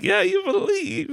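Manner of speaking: put-on high, slow childlike echo; mock child voice. Dad teasing son, slow high mock child voice. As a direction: tease back in a squeaky kid voice.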